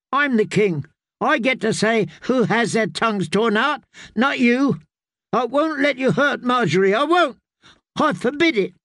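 put-on a child king shouting in fury; boy's sudden shout. Young king shouting at his mother to defend Margaery.

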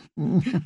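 a short, airy lady's laugh; light laugh. Acted. Noblewoman laughing at the Queen's question. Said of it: "Lady Merriweather gave a laugh".

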